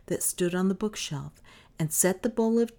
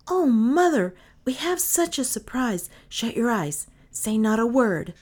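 high, loud, bursting with a secret; excited children; 6 semitones higher, 5 dB louder than usual. A narrator's reading. Children telling their mother to shut her eyes, excited. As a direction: kids who can barely hold in a surprise.

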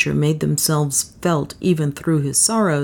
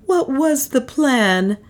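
high, slow strained question; strained woman's voice, pitched high; hoarse question; 9 semitones higher, slower than usual. A narrator's reading. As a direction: ask slowly, with a touch of hoarseness. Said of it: "she asked hoarsely".

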